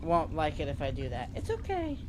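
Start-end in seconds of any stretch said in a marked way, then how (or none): 1.4-2.0 very high cooing reassurance; high baby-talk to the pet; soothing dog voice. Pretend. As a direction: coo it to calm the upset dog.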